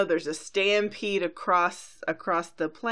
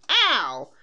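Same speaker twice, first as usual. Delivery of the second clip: loud, higher-pitched "ow"; mock yelp; 5 semitones higher, 7 dB louder than usual. Twilight bowled over by the ball of fighting, mock yelp. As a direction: yelp it like a cartoon pony being hit. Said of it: "She goes".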